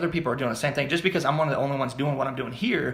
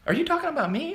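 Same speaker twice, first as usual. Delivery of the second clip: suspicious friend. Retold or imitated. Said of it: "people are like".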